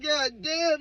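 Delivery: a high, drawn-out god damn; exasperated. Retold or imitated. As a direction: sigh it out, baffled.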